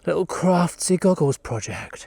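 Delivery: ordinary talk, nothing marked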